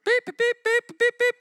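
high, loud bleeping; censor bleep. Shown as a demonstration. Preacher bleeping out the callers' swearing, high and loud. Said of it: "It's all censored".

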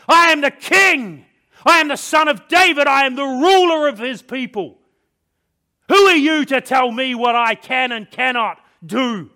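high, very loud royal outburst; furious cry. A narrator's reading. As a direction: thunder your title at him, drawing out the key words. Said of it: "I cried".

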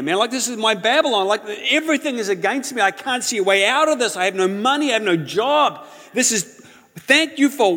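high, loud, pleading protest; desperate outburst. Retold or imitated. Young man protesting to God in prayer, high and loud. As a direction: cry out that nothing is left to be thankful for. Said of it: "And what was my reply?".

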